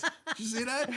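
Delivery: an incredulous laugh at what rolls past; amazed laughing question. Retold or imitated. Father spotting runaway car chassis.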